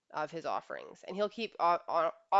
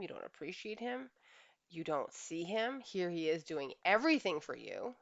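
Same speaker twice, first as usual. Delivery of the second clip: ordinary talk, nothing marked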